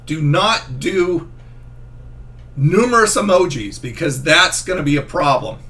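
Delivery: ordinary talk, nothing marked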